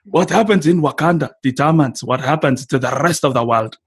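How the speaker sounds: put-on measured Wakandan accent with open vowels; Wakandan-accented film quote; T'Challa's Wakandan accent. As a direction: put on a Wakandan accent, every word carefully sounded. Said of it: "that line that T'Challa says".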